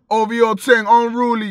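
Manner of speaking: mock the fake UK accent. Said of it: "You don't have to talk like".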